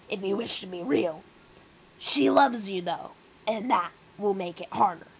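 forceful, aggressive Rat King. Acted. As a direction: press the advice hard, as the rat. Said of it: "that is an aggressive voice".

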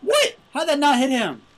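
high, loud, outraged question; incredulous yell. Real, not acted. Gamer asking why the shot missed, incredulous yell. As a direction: shout it in utter disbelief.

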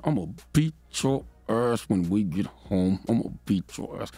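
low-pitched mock parental threat. Mother intimidating her nine-year-old witness, mock threat. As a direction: imitate a mother warning her child, word by word. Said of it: "as soon as she tells her nine-year-old".

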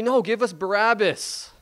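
high, slow, drawn-out call; crowd's demand. Acted. Acts crowd demanding a prisoner.

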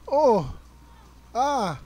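loud high oh then ah; jolted by a hit; startled cry. Real, not acted. Player jolted by a game hit, crying out.

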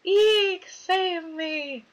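high squeaky eek, save me; mock terrified squeal. Player squealing in mock fright at the game. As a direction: shriek it like a cartoon victim. Mock terror at the scary scene.

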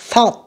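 "Thought" with an unvoiced TH; just air on the TH of "Thought"; voiceless TH. Shown as a demonstration. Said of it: "cũng không rung ha". ("Thought" với âm TH không rung)

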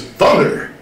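rising to a high, loud shout; hero's rallying cry for the boys; Thundercats battle cry. Retold or imitated. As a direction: build the cry up to a big ho. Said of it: "he's the one who says".

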